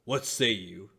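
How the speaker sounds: loud, projected question; loud joint prompt. Acted. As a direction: call it out boldly as a challenge. Two bosses ask in unison.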